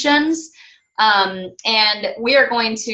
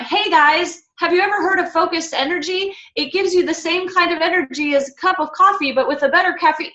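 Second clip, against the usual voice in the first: peppy sales pitch, pitched well up; salesy ad pitch; 8 semitones higher than usual. Shown as a demonstration. Coach mocking a spammy product post, high peppy sales voice. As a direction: sell it like a cheesy commercial. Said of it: "that's like an advertisement".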